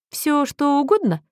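high curious question; intrigued. A narrator's reading. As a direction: ask it with keen interest. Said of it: "заинтересованным видом переспросил Мэтью".

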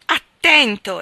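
loud abrupt warning; sharp shout. A narrator's reading. Narrator warning Monk at the wheel, a loud sharp shout. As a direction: snap out the warning loudly. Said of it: "esclamai bruscamente".

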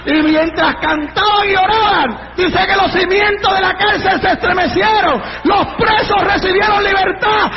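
driving shouted climax to a Bible story; fervent revival crescendo; full-force preaching. Real, not acted. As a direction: build phrase on phrase to a ringing shout.

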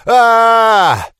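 long loud high howl of pain; full-throated roar of pain. A narrator's reading. Hero pouring salt into his wound, roaring in pain. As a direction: bellow it out with everything. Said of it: "заревел я во всю глотку".

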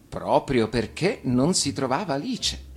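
usual speaking voice

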